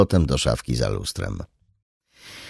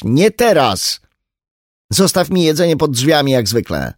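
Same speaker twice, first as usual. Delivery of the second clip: louder, higher dismissive reply; curt refusal; 9 semitones higher, 6 dB louder than usual. Acted. Daughter dismissing her mother through the door, loud and curt.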